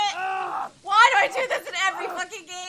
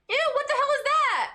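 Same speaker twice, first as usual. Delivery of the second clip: normal talking voice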